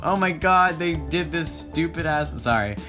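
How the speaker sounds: ordinary delivery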